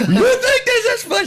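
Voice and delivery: high mock-shrill wife voice, laughing through it; imitating his wife. Husband mimicking his wife scolding him from jail, high and laughing. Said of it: "She says the bad news".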